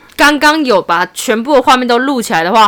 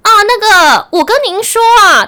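higher, louder imitation of a Mainland Chinese accent; 9 semitones higher, 4 dB louder than usual. Scam caller talking to a Taiwanese buyer, Mainland Chinese accent. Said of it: "中国腔".

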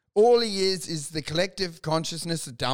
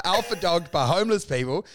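ordinary talk

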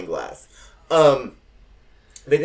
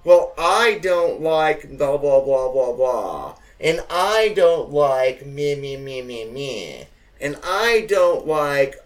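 slow, louder sing-song mockery of picky eaters; mocking whiny complaints; 6 dB louder, slower than usual. Mocking the relatives' pizza complaints, slow and whiny. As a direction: drag out each blah and me to mock them. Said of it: "it turned into".

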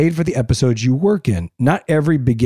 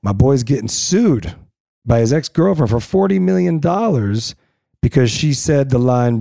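usual speaking voice

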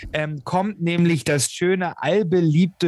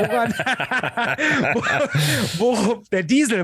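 laughing; 5 semitones higher than usual. Real, not acted. Laughs at own story.

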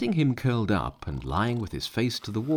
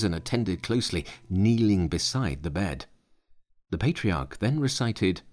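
plain reading voice

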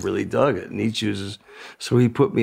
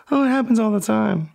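lightly imitating Michael; 9 semitones higher than usual. Michael answering, voiced high.

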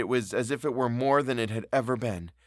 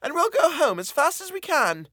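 high, flustered coaxing; flustered appeal; 12 semitones higher, 3 dB louder than usual. A narrator's reading. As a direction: coax the child home nervously. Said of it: "she appealed, blundering".

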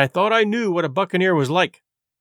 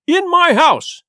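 high, loud exclamation; outraged cry; 11 semitones higher, 6 dB louder, slower than usual. A narrator's reading. Silver hearing a buccaneer was there, outraged cry. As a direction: burst out in indignation. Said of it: "cried Silver".

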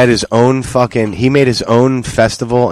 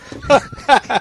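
very high squealing laugh; 4 dB quieter than usual. Real, not acted. Laughing at the silly name.

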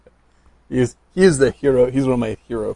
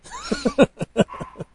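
high burst of laughter; big laugh. Real, not acted. Laughs at own punchline.

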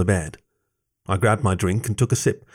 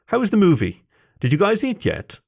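higher-pitched mother's voice; motherly; 11 semitones higher than usual. A narrator's reading. Mom asks the boys about movie and food, motherly. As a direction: ask the boys in a lifted, motherly lilt.

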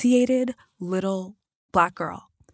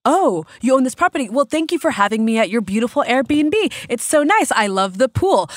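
mock-sweet, louder and higher; sarcastic; 5 semitones higher, 5 dB louder than usual. Retelling the man in the woods, sarcastic and bright. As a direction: fake delight to call out his lie. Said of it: "I'm like".

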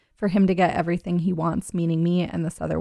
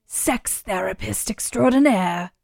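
raspy whisper; 2 semitones higher, slower than usual. Pretend. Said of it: "weird raspy whispery voice".

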